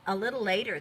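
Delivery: plain reading voice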